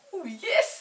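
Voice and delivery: put-on eager little girl agreeing; Molly eagerly agreeing, high excited girl. Molly voiced in story.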